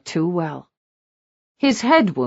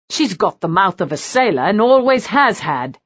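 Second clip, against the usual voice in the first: ordinary delivery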